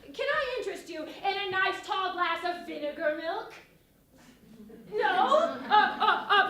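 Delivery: loud, high, eager innkeeper welcome; Mistress Quickly. Acted. Innkeeper welcomes lords and ladies to the Boar's Head, loud and eager. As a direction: hawk the odd dishes like a hearty hostess.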